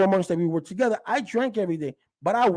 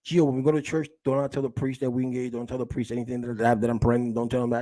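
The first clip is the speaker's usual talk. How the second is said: a lightly voiced, lower, hushed father; mock the father quietly pleading in a low voice; imitating her father; 7 semitones lower, 3 dB quieter than usual. Her father asking Gio not to tell the priest, lightly imitated low. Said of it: "he was like".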